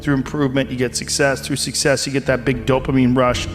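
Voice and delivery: normal talking voice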